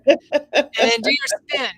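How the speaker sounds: high, loud, laughing and slow. Real, not acted. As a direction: giggle through the instruction. Laughing playful instruction.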